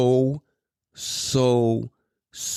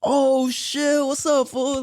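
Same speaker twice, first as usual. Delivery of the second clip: excited greeting; 13 semitones higher, much slower than usual. Shown as a demonstration. Greeting a friend he hasn't seen, excited call. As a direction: greet an old homie with delight. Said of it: "Or it's like".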